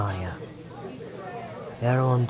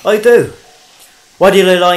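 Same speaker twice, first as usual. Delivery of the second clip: much louder, higher hard accusation; forceful accusation; 4 semitones higher, 10 dB louder than usual. Acted. Interrogator confronting the lying witness, forceful and loud. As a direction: slam the question at him.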